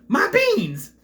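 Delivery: high, loud squealing "My beans!"; mock Jorgen yell. Imitating Jorgen to a viewer, a high loud squeal. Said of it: "That's how he says that".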